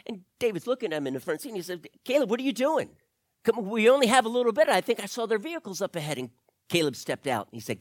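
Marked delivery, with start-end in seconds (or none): 2.0-6.2 higher and fast, pressing the driver on; urgent impatience. Acted. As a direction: hurry him, we're so close. David urges Caleb to keep driving.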